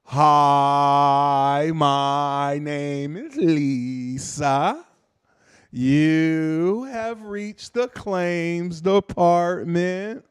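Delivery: extremely slow, stretched-out syllables; mock impaired speech, very drawn out; stroke-slowed speaker. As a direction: drag every vowel out laboriously. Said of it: "Because I had a stroke".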